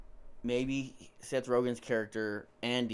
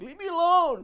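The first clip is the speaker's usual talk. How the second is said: high pleading victim voice; whiny high plea; 10 dB louder than usual. Retold or imitated. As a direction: beg them to leave you alone.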